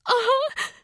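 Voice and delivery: small high tearful whimper. A narrator's reading. Crying girl answering Kelly, whimpering. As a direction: whimper through tears. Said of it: "she whimpered through her tears".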